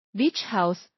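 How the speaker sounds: ordinary delivery, nothing marked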